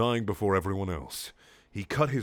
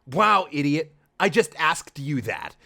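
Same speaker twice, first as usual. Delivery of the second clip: higher, louder snippy voice; mock annoyed anger; 4 semitones higher, 5 dB louder than usual. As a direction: snap it like an irritated hero. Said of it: "in an angry and annoyed way".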